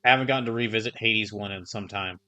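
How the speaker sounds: ordinary talk, nothing marked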